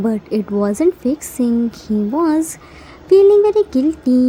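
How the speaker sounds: usual speaking voice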